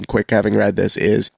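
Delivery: normal talking voice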